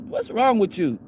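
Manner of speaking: usual speaking voice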